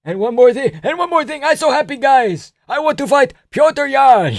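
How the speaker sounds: put-on high loud accented speech; elated high Georgian speech; Merab impression. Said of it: "Merab hijacks the post-fight speech".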